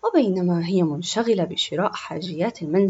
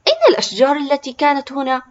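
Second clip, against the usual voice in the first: high, loud, quick question; astonished; 6 semitones higher, 8 dB louder, faster than usual. A narrator's reading. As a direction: blurt it out like an amazed little boy. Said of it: "وقال متعجبا". (تعجّب بصوت عالٍ وسريع)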